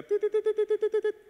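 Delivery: playful imitated electronic beeping. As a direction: chirp out the tits like a beeping alarm. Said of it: "que se oiga".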